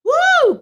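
loud, high-pitched "woo"; worship whoop. Real, not acted. Preacher thanking God mid-sermon, high ecstatic whoop. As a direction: let out a sudden high whoop.

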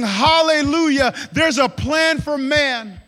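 loud, exultant proclamation; joyful cry, drawing out the ending; jubilant uproar. Acted. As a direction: shout it out like heaven's breaking news. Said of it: "were in an uproar".

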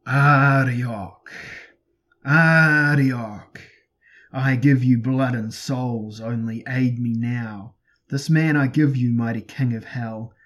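loud, drawn-out invocation; booming, stately cry for aid; loud rolling yell. A narrator's reading. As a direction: summon a dark god at full voice, slowly. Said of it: "yelled loudly in a deep, rolling voice".

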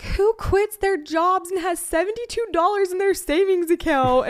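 high, loud, slowed disbelief; half-laughing wail of disbelief at seventy-two dollars; incredulous outburst. Retold or imitated. Retelling disbelief after quitting.

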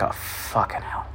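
slow, breath-only curse; low, hushed, dragged-out dismay; muttered exasperation. Retold or imitated. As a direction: curse under your breath, resigned. Retold dismay at leaving.

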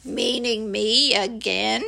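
slow, doleful question; ponderous donkey voice; disbelieving gloomy Eeyore. Acted. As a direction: drag it out doubtfully. Disbelieving Eeyore asks again.

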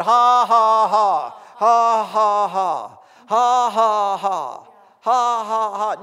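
very loud, deliberate forced laughter; Brother Hagin's dry laugh. Retold or imitated. Preacher imitates a believer answering the devil, loud forced laughing. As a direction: belt out each "ha" with no real amusement. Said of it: "Brother Hagin said".

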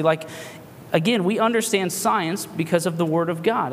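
ordinary talk, nothing marked

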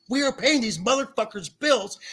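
normal talking voice

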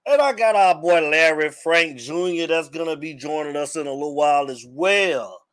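usual speaking voice

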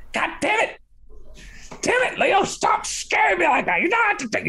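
very high, squeaky startled cursing; cursing Larry. Acted. Startled Larry yelling at Leo behind the door, squeaky cursing. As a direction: squeal the curses like a jumpy little man. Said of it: "He's like".

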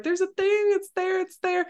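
very high-pitched mock-hysterical girl; imitated sobbing panic. Girl insists something is behind the door, imitated high panic. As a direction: mimic a terrified child's high wail, without real tears. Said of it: "Christine is sobbing".

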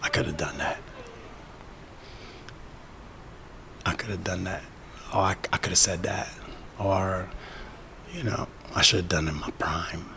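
quiet, wistful looking back; regretful whisper. Pretend. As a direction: murmur it like an old regret. Voicing regretful future self.

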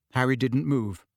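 ordinary delivery, nothing marked

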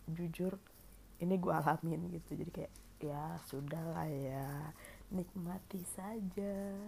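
usual speaking voice

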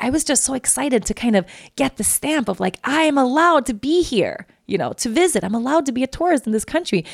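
high, dreamy excitement; excited friend. Retold or imitated. Maya gushing about her visa stamp, excited. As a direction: gush like she did.

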